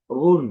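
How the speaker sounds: heavy, loud "রুন"; thick R. Shown as a demonstration. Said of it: "মোটা".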